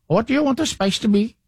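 put-on Indian accent. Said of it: "very thick Indian accent".

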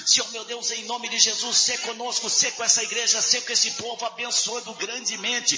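fervent, half-sung prayer swelling to cries; closing prayer. Acted. Pastor praying aloud over the standing congregation, fervent sung prayer. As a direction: pray over the church, rising in fervour.

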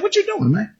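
high, soft what-are-you-doing; puzzled. Retold or imitated. Aide asks Moses why he hits the rock, high and puzzled. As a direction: sound baffled, voice way up.

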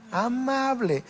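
"Amable" dicho aparte, agudo y suave; énfasis en amable. Shown as a demonstration. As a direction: recalca "Amable" con calma. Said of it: "lo opuesto es ser amable". (repite "Amable" más suave y más agudo)

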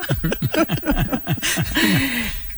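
amused burst of laughter. Real, not acted. Woman telling interviewer about the blocked convent toilet, laughing. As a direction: laugh at your own anecdote.

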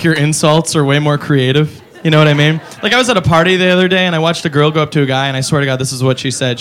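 ordinary delivery

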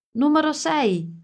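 calling out a number on set; shout. A narrator's reading. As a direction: call it out across the lake. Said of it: "urlò Alice".

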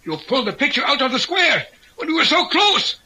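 very high, accusing cry building to a shout; anguished outrage. Acted. Leader to partner who broke the square, outraged. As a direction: wail the accusation, then shout.

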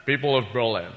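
low Scottish-tinged speech, citizen said shitizen; lightly voiced Connery doing a speech; Sean Connery as Kennedy. Comedian does Sean Connery imitating Kennedy's Berlin speech. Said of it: "Sean Connery doing, uh, President Kennedy".

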